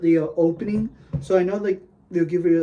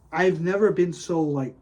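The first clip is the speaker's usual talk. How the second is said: ordinary delivery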